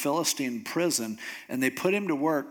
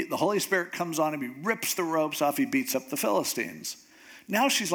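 ordinary delivery

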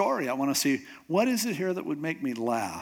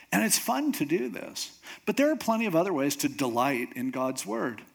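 usual speaking voice

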